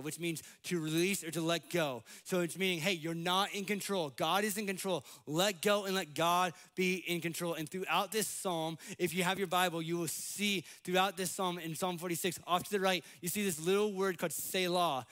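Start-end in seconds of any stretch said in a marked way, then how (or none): none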